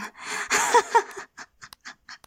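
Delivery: high, breathy laugh. Acted. Domineering character after giving orders, high breathy laugh.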